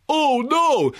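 mock the actor's anguished cry; Nicolas Cage impression. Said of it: "is just Nicolas Cage going".

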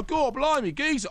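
over-the-top London gangster; Guy Ritchie Cockney. Retold or imitated. Critic mocking film gangsters, high Cockney. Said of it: "they're like this".

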